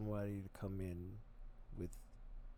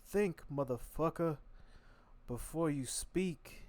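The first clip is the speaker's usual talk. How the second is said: slow, drawn-out higher warning from dad; dad scolding; 6 semitones higher, slower than usual. Retold or imitated. Imitating his dad telling him to think first, slow and higher. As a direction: stretch each word like a stern father lecturing. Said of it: "My dad used to always be like".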